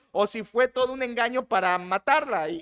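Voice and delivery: usual speaking voice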